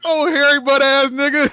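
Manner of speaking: laughing. Real, not acted. Laughing at movie scene.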